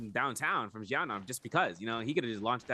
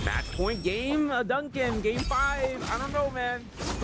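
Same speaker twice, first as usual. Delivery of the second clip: high, slow hype call at match point; excited; 13 semitones higher, 4 dB louder, much slower than usual. Real, not acted. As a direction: build the tension, voice pitched way up. Casters hyped at match point.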